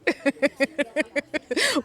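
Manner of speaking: short, high chuckle; light, high-pitched laugh at your own joke. Real, not acted. Interviewee joking that she is the whole board, laughing.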